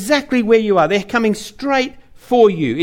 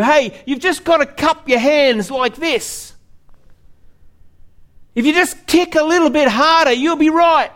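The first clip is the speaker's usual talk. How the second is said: lightly voiced pushy helper showing the stroke; eager know-it-all swimmer; 8 semitones higher than usual. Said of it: "someone says to you".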